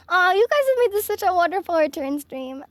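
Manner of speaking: usual speaking voice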